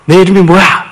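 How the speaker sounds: possessed father's question. Retold or imitated. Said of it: "이렇게 질문도 던졌죠".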